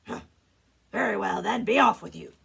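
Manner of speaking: grudging, booming troll dismissal. Acted. Troll lets the second goat go, gruff and booming. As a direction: send him off with a loud bark. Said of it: "said the troll".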